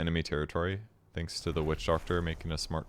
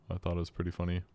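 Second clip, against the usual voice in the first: ordinary delivery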